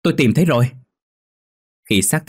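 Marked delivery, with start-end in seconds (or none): 0.0-0.7 louder, higher excited call; shouting. A narrator's reading. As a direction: shout out the discovery. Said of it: "liền hô to". (hô to mừng rỡ)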